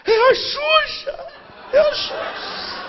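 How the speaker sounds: very high, slow, tearful shriek; crying fan shriek. Retold or imitated. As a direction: wail the name like a hysterical fan. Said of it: "chorando e gritando". (fã gritando É a [name] chorando)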